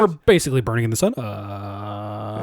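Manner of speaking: lightly voiced mock captain; casual cockpit patter, quick, then a long low uh; a hint of unbothered pilot; airline pilot. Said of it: "He was a pilot".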